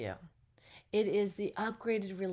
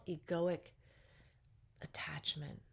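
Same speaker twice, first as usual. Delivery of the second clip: much softer whispered word; 6 dB quieter than usual. Real, not acted. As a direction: let it fade to a whisper. Trailing word falls to whisper.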